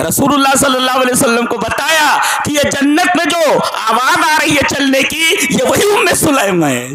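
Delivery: full-force fervent sermon shout; ecstatic preaching. Real, not acted. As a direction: cry out the reveal in joy. Climax about footsteps in paradise.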